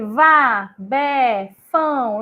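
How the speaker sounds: slow, loud, one syllable at a time; deliberate syllable-by-syllable reading aloud. Shown as a demonstration. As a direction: sound out each syllable slowly like a child learning to read. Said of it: "Aí ele".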